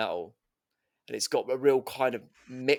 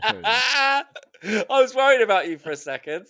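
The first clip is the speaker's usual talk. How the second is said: laughing; 8 semitones higher, 8 dB louder than usual. Real, not acted. Laughing at co-host's joke.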